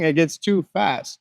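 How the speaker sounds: usual speaking voice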